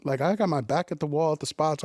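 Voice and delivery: normal talking voice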